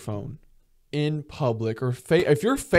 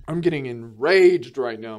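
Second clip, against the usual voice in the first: ordinary delivery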